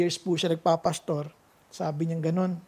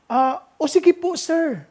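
eager reply; 9 semitones higher, 7 dB louder, slower than usual. Retold or imitated. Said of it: "Sabi niya".

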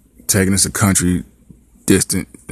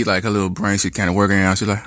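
ordinary delivery

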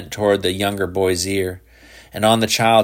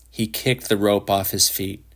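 ordinary delivery, nothing marked

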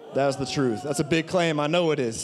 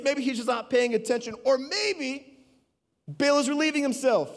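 higher, sneering taunts; mocking; 8 semitones higher than usual. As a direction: ridicule a god who never answers. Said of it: "Elijah began mocking them".

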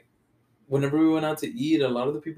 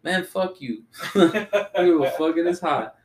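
mock-indignant comeback through laughter, pitched up; laughing retort; 6 semitones higher, 4 dB louder than usual. Texan answering a coworker who belittled the heat, laughing retort. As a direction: laugh while retelling a higher, louder retort. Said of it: "And I was like".

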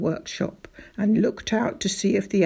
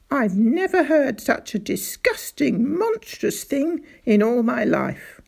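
furious; 3 semitones higher, 5 dB louder than usual. Acted. Little doctor rants to father about tiger traps, furious. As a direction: rage at the monstrous trap. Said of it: "jumping up and down with fury".

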